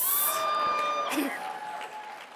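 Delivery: long, high laugh. Real, not acted. Speaker enjoying crowd's reaction, long high laugh. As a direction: laugh after your own punchline. Laughing after own punchline.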